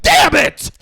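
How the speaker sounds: very high, loud hammed-up rage; mock furious yell. Father yelling in the kitchen, hammed-up furious scream. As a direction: scream it like you're punching a cabinet.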